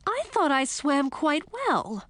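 slow, high, wounded princess; hurt pride. Acted. Princess defending her newly learned swimming, high and hurt. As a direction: protest slowly in a high, offended voice.

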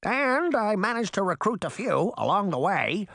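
high bird voice presenting to a crowd; squawking crow. A narrator's reading. Crow introduces the new aerial spy network, high squawk. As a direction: announce each one in a high crow squawk. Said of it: "the exhausted crow squawked".

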